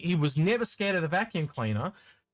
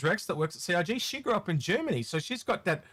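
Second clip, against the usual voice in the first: usual speaking voice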